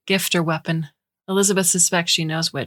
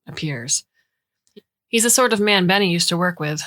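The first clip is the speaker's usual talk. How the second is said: usual speaking voice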